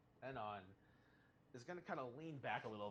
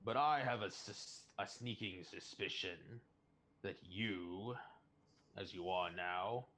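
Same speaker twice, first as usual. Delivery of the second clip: slow, lower voice with deliberate pauses; level with him slowly, weighing each phrase; measured, probing prisoner; 4 semitones lower, slower than usual. Acted. Anon voices a suspicion to Orc in prison, measured and probing.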